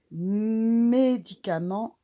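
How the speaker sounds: drawn-out loud Médicament; slow model word. Shown as a demonstration. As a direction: sound out Médicament for the children. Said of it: "on entend le mé".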